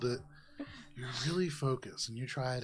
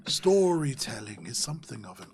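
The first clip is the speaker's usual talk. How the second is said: pompous, whispery lecturer swelling louder; 2 semitones higher, 4 dB louder than usual. Acted. Javaris lectures Valis on storytelling, pompous mystic. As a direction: lecture ponderously on your art. Game master voices Javaris.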